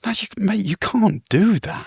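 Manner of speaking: high, drawn-out disbelief; exasperated reproach. Retold or imitated. Reproaching the noisy guest in a hostel, exasperated.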